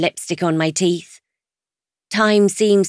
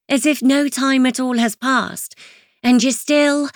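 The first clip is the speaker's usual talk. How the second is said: plain reading voice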